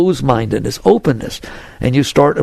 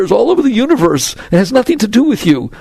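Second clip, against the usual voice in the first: normal talking voice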